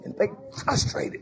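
hushed, high whispered sigh of God; whispered lament. Acted. Preacher voicing God, whispering his dismay at people. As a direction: breathe it out, dismayed.

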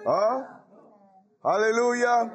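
ordinary talk, nothing marked

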